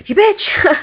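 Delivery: high-pitched indignant retort; hammed-up offended squeal; mock outrage. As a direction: exclaim it in playful outrage. Acting out tricked girl's retort.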